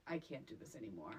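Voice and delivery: soft, breathless giving up; exasperated whisper. Retold or imitated. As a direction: whisper it like you're done. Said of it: "when I was like".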